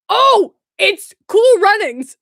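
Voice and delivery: loud, high, slow outburst; excited realisation. Real, not acted. Remembering the movie title, real excited outburst. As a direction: cry out as it suddenly clicks. Suddenly remembers the film, bursts out.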